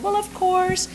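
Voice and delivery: high, bright-eyed "of course"; mock eager agreement. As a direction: play up how gladly you said yes. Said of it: "and I said".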